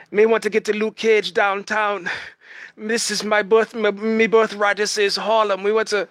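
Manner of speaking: hammed-up Jamaican villain boasting of his birthright; Bushmaster accent. Fan imitating the villain's claim on Harlem in a hammed-up Jamaican accent. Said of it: "terrible Bushmaster accent".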